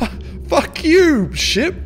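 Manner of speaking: high, loud, slow stuttered curse; angry outburst. Real, not acted. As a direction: swear furiously at the ship. Jolted by the ship.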